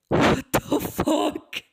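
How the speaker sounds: loud, slow, high-pitched shock; loud comic bafflement; appalled disbelief. Acted. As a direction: stretch it out in horrified disbelief. Acted reaction to carpeted kitchen.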